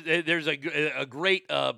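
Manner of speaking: ordinary delivery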